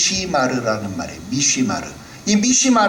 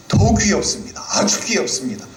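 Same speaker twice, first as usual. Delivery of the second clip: ordinary talk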